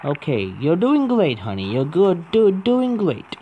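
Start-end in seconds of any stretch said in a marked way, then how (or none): 1.4-1.7 soft low endearment; soothing. Real, not acted. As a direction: coo it softly. Soothing the character.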